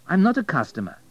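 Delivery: high, emphatic objection; insistent protest. Acted. Abel protests her refusal.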